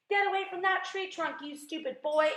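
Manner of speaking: high, scolding yell; angry yell. A narrator's reading. Aunt orders the boy off the tree trunk, angry yell. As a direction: yell a sharp warning. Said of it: "Aunt Spiker yelled".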